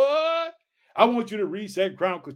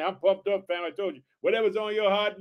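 usual speaking voice

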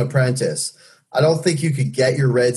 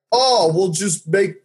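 put-on Russian accent; 9 semitones higher than usual. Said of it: "I can make a Russian accent".

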